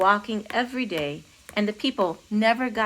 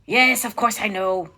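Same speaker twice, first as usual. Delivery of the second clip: louder impatient retort in mock French accent; impatient king; 5 dB louder than usual. A narrator's reading. King replying to his counselor, impatient French accent. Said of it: "the king replied impatiently".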